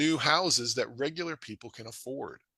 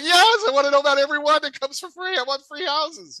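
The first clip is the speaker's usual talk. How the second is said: much higher and louder, eager near-shout; excited demand; 13 semitones higher, 8 dB louder than usual. Retold or imitated. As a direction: insist like a kid wanting candy. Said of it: "I'm like".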